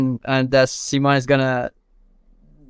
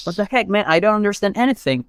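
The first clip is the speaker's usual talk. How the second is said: retold exasperation, pitched higher; a touch of frustrated protest; mildly pissed off; 5 semitones higher, faster than usual. Worker retelling being spoken to in Catalan, mildly pissed off. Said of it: "I got a bit pissed off".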